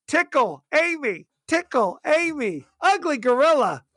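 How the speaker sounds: lightly put-on high gorilla translator voice; crazy voice. Co-host imitates the gorilla's sign-language backpack, lightly crazy voice. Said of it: "a crazy voice goes".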